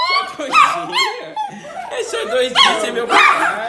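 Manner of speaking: agudo e alto, entre risadas; rindo. Real, not acted. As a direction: ria enquanto fala. Ri falando com o cão. (falando rindo, voz aguda)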